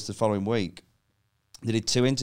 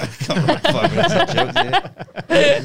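exasperated protest; 6 dB louder, slower than usual. Retold or imitated. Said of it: "I was like".

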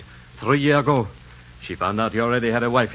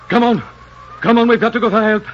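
urgent; 11 semitones higher, 7 dB louder than usual. Acted. Urgent call to go for help.

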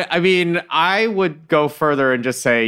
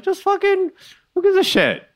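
exasperated. Real, not acted. Exasperated rant about caller anonymity.

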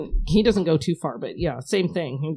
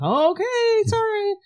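much higher, faux-sweet sorry; mock innocent apology; slower than usual. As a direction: play dumb, all fake innocence. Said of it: "be like".

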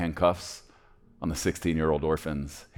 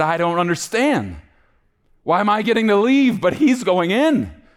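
high, urgent cry building louder; bewildered protest; 14 semitones higher, 7 dB louder than usual. Acted. Boy asks why he gets to leave, loud and distraught. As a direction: plead loudly for an explanation.

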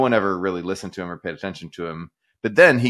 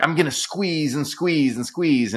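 higher and slower, a hammed-up villain; supervillain voice; 7 semitones higher, slower than usual. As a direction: gloat it out like a cartoon supervillain. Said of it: "dressed up to sound like a supervillain".

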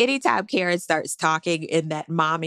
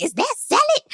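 much higher, clueless put-on voice; high mock Eddie; 4 dB louder than usual. Recapper mimics clueless husband at dinner, high mock voice. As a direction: play him as a high, baffled man. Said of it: "'Cause he was like".

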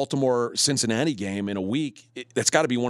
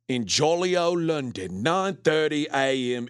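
put-on Peaky Blinders accent. Host reads the London game in a hammed-up British accent. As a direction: lay on a dubious English accent, drawling it out. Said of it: "That's my Peaky Blinders accent".

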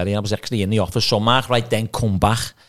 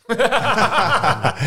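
high loud laugh; burst of laughter; 13 semitones higher, 4 dB louder than usual. Real, not acted. Telling the wedding story, real burst of laughter. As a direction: laugh out at the memory.

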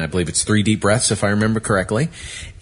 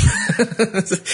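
a big, high burst of real laughter; host cracks up at the arrest mix-up; hearty laugh. Real, not acted. Host laughs at drug user story.

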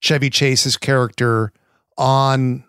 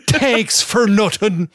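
put-on Irish accent; 8 semitones higher, slower than usual. Mimicking Irish caddie in movie.

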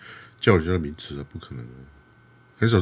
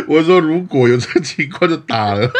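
higher, louder, laughing through the words; 8 semitones higher, 5 dB louder than usual. Real, not acted. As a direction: say it while still cracking up. Laughing while explaining. (边笑边说)

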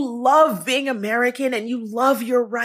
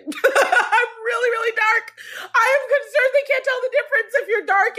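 high, cracking laugh-cry; fighting back tears with a laugh; real tears breaking through a nervous laugh; 12 semitones higher than usual. Real, not acted. Breaks down admitting fear.

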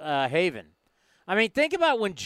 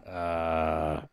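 low, lazy airline captain on the intercom; pilot voice; 10 semitones lower than usual. Pretend. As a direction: talk like the captain announcing to the cabin. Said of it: "that's my pilot voice".

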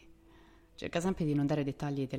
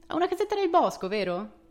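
teasing sing-song; 12 semitones higher, 7 dB louder than usual. Acted. Narrator teasing brother.

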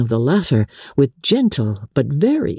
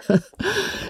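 rueful laugh; 6 dB quieter than usual. A narrator's reading.

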